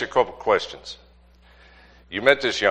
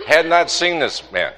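laughing marvel; 7 semitones higher, 4 dB louder than usual. Real, not acted.

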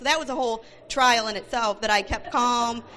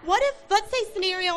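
higher, sweetly polite pleading; mock sugary politeness, pitched up; trying to be nice; 8 semitones higher than usual. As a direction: put on a nice voice to win her over. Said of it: "I'm trying to be nice".

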